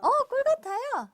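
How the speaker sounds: high, loud delighted repeat; excited echo. Real, not acted. Echoing child excitedly.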